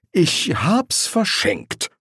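high, slow, reluctant confession; a little defiant. A narrator's reading. Angel confessing he gave the sword away, slightly defiant. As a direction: admit it slowly with slight defiance. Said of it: "sagte er ein wenig trotzig".

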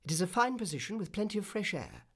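lightly voiced, pleased swallow; pleased cry. A narrator's reading. Swallow approving his fine position, mildly crying out. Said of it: "he cried".

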